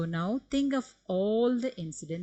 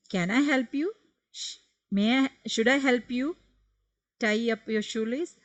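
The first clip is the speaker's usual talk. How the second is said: higher, louder eager offer; acted offer of help; 6 semitones higher, 5 dB louder than usual. Shown as a demonstration. Teacher playing a child offering to tie a shoelace, higher and louder.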